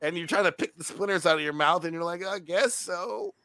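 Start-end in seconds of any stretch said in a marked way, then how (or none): 2.2-3.3 slow, higher, softer mock hesitation; imitating a very unconvinced customer; reluctant agreement. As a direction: drag it out as doubtful, half-hearted assent.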